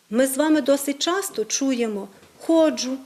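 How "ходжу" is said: «ходжу» stressed on the first syllable; діалектний наголос. Shown as a demonstration. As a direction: say «ходжу» as KHOdzhu, dialect stress. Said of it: "вплив діалектного мовлення". («ходжу» з наголосом на першому складі)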